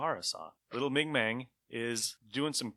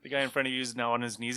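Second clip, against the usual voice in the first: ordinary talk, nothing marked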